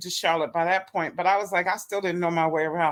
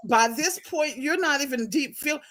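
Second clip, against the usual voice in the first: raised pitch, mock outraged telling-off; high indignant retort; 7 semitones higher than usual. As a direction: cut him off, offended. Her indignant reply to him.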